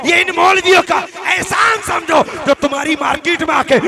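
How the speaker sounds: loud, high, rousing sermon shout; impassioned shouted exhortation; full-force preaching. Real, not acted. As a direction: preach it to the back of the crowd.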